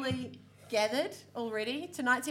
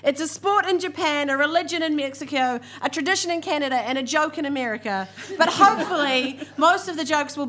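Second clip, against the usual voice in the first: announcer; 2 semitones higher, 9 dB louder than usual. Acted. Host's opening spiel.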